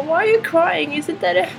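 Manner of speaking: put-on high, slow, puzzled child; little girl voice. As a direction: ask it innocently like a small child. Voicing the four-year-old. (liten flicka, hög och långsam)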